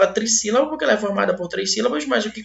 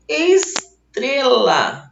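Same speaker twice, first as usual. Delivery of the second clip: "Estrela" stretched very slowly, "Es... trela", raised pitch; sílabas alongadas; 4 semitones higher, 4 dB louder, much slower than usual. Shown as a demonstration. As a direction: draw out "Estrela", splitting after "Es". Professor alonga a palavra de exemplo. ("Estrela" bem alongada, separando "Es" de "trela")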